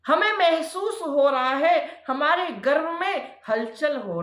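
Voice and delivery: lightly voiced pleading wife, pitch lifted in waves; pleading Diti. Wife confessing to her sage husband, anxious pleading.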